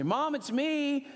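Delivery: calling out high and slow into the house; a hint of calling out to mom. Calling out to his mother so he doesn't scare her, high and drawn out. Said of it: "I always raise my voice when I enter".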